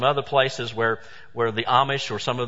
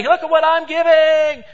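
high, loud bragging voice; mock proud giver calling for attention; boastful show-off; 14 semitones higher, 9 dB louder than usual. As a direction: crow it out like a show-off.

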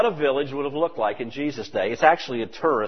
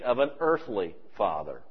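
usual speaking voice

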